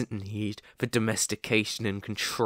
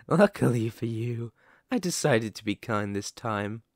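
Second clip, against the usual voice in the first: ordinary talk, nothing marked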